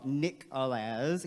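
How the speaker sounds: "Nick Alaz" slow, last syllable rhymes with jazz; wrong last syllable. Shown as a demonstration. Teacher showing a wrong reading of his name, slow. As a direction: say "Nick Alaz" slowly, ending -laz. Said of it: "my name is not Nico".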